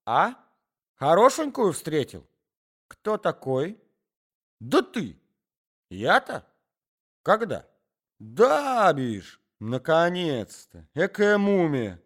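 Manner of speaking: put-on a garrulous old man starting a tale; old man's voice. Old man and wife bickering.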